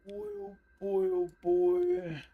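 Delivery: thin falsetto reaction; silly, tiny high voice; very high squeak. Pretend. Reacts to the edited face.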